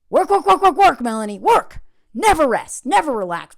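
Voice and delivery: loud, high barking taskmaster; harsh dictator voice. Retold or imitated. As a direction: shout orders like a tyrant. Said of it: "this violent little dictator inside of me".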